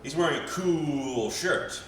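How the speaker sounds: "cool" held and savoured, slow; drawn-out COOL. Acted. Wary passers-by on seeing his outfit, drawn-out COOL. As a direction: stretch "cool" long, as impressed onlookers. Imagined onlookers repeat the punchline.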